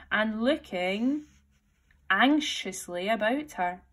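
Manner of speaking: usual speaking voice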